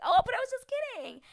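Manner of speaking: high, fast, sing-song fake innocence; mock backpedal. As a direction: squeak it out like a caught liar. Retelling friend's awkward backpedal.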